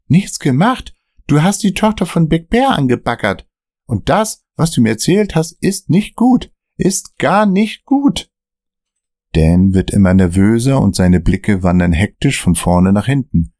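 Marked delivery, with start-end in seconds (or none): 0.1-8.3 much higher, panicked cursing; he thinks they're done for; panicky Dan. Acted. Dan cursing at Michael during the car chase, panicked.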